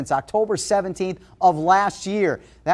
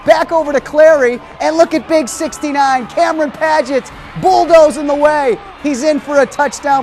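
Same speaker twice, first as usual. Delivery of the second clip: higher, louder, rousing highlight commentary; excited play-by-play; 7 semitones higher, 5 dB louder than usual. Acted. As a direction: call the plays like a stadium announcer on fire.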